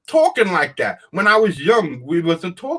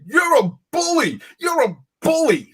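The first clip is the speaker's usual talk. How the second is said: mock whiny accusation; 11 semitones higher than usual. Mocking accusers' words.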